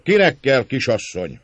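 loud, high auctioneer-like call; hawking shout. A narrator's reading. Márton offering the lady to the lads, loud jeering shout. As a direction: cry her out to the crowd as if on offer. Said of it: "Kiáltta a tömeghez fordulva".

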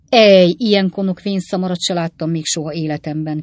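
loud exasperated scolding; loud angry cry at his stubborn father; angry outburst. Acted. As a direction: let the anger burst out. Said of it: "kiáltott fel Sam haragosan".

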